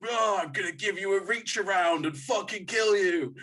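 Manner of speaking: mock the characters trying to sound edgy; mock tough-guy threat. Film characters at the end, hammed-up threat. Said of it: "they're like".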